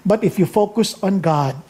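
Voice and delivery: low, quiet, drawn-out final punchline; hushed sermon payoff; climactic soft close. Acted. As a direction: let the last word land softly. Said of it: "climactic punchline, dropping soft".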